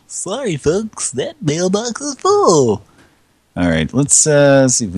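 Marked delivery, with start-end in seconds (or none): 0.1-2.8 high, slow robotic voicemail voice; mock recorded voice. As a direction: parrot the answering machine back, drawn out. Mocking the full-mailbox recording.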